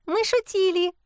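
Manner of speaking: high little squeak; squeaking kikimora. A narrator's reading. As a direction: squeak it timidly, high-pitched. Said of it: "тихо пискнула ещё одна кикимора". (тонкий писк кикиморы)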